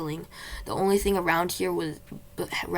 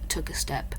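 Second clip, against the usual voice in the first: usual speaking voice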